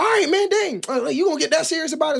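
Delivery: high-pitched mock protest; exasperated brush-off. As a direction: act out a defensive friend who thinks it's no big deal. Acted dismissive reply in quarrel.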